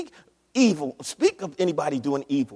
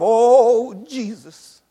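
mock panicked prayer; 4 semitones higher, 8 dB louder, much slower than usual. Preacher mocks the crisis believer crying out to Jesus. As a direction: send up the desperate believer.

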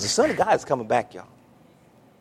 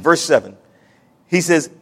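ordinary delivery

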